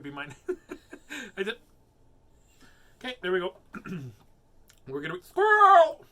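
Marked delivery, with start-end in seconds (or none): 5.2-6.0 sudden high shout; joking high-pitched outburst; mock distracted yell. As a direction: blurt it out loudly as if suddenly distracted. Mock sudden distraction, then "just kidding.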